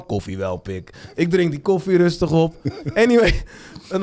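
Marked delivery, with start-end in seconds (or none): 0.0-0.9 imitating a friend's low murmur; hushed low warning to a friend; low mumbled warning. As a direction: drop the voice low and mutter it. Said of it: "Ik zeg".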